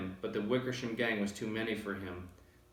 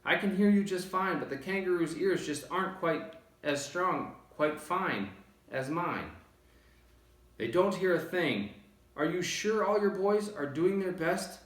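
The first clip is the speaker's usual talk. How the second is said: calling back; 7 semitones higher than usual. A narrator's reading. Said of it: "Horton called back".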